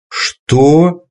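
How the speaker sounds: sharp high shout of disbelief. Acted. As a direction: yell it in shock. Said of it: "закричал Минигон".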